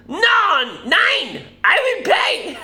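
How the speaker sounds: very high, loud, hammed-up German accent; mock German accent. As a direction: squawk it as an over-the-top outraged German. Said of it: "Is that a German accent?".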